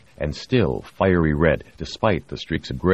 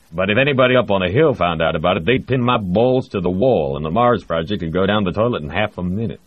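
fast, louder, worked-up Texan; heated warning; 4 semitones higher, 5 dB louder than usual. Acted. Texan director warns what Congress would do, fast and heated. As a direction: rush it out, heating up.

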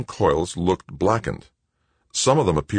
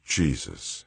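low, soft moan of dismay; dismayed, dropped in volume; moaned oath; 3 semitones lower, 5 dB quieter than usual. A narrator's reading. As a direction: moan it softly. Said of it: "he moaned".

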